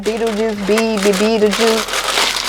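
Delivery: stammering over the name; tongue-tied stumble. Real, not acted. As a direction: trip over the word. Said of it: "like, tongue-tied".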